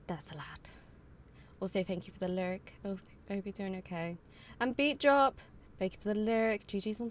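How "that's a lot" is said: soft whispered aside; whispering. Real, not acted. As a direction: whisper it to yourself. Whispered repeat.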